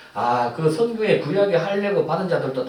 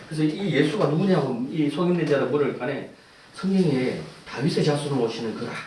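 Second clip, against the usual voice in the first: normal talking voice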